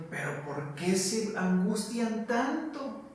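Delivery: put-on a loving father chiding his worried children; preacher lightly voicing God reassuring his children. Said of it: "yo me imagino a Dios como diciendo".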